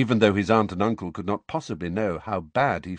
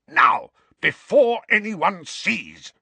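high, loud, slow snarl in the ear; 12 semitones higher, 7 dB louder, slower than usual. A narrator's reading. Uncle snarling at nephew to hide the wand. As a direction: snarl it at him through your teeth.

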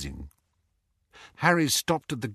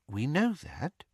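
quietly; 3 semitones higher, 5 dB quieter than usual. Acted. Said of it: "said Mister Weasley quietly".

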